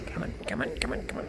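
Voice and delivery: quick muttered whisper under the breath; urgent whisper. Real, not acted. Player grabbing a real world object, urgent whisper. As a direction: coax it along in a hushed whisper. Urging while reaching for an object.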